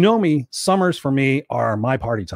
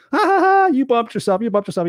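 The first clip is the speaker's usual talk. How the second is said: high-pitched, teasing ha-ha-ha; mock laughing; 10 semitones higher than usual. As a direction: laugh off a child's bump, exaggerated and high. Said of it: "I started laughing".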